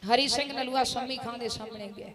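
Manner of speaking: usual speaking voice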